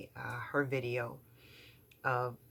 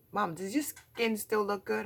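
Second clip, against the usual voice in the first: daughter's question; 7 semitones higher, 4 dB louder than usual. Retold or imitated. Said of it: "she was like".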